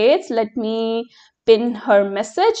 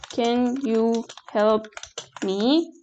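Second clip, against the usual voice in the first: slow, softer dictation pace; dictating slowly; 6 dB quieter, slower than usual. Shown as a demonstration. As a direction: spell it out slowly for the chat. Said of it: "Question mark".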